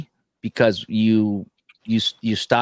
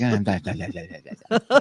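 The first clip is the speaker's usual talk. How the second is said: laughing blah blah; 2 semitones lower, 4 dB quieter, slower than usual. Real, not acted. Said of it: "I'm just like".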